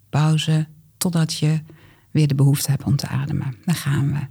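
calm guided exercise voice; walk them through it gently; guided breathing. Acted. Leads the exercise together.

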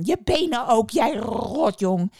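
loud, slow witch scolding. A narrator's reading. Witch loudly scolds the boy in the pan. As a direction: drag out the insult loudly.

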